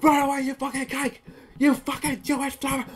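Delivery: high, strained mock shouting; imitated yell. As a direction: act out hateful shouting under cover. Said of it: "you gotta be like".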